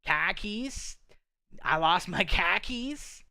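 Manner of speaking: put-on slow Boston accent, lifted at the end; Boston, New York accent. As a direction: draw it out like a Bostonian. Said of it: "that's like a Boston, New Yorkian kind of saying".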